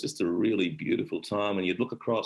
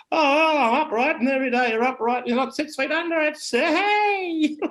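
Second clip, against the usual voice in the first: put-on much higher, louder old bloke, cracking with glee; Ron Palmer; 5 dB louder than usual. Old Ron telling the host he's still upright, high and gleeful. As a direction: crow it out like a spry old digger. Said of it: "He said".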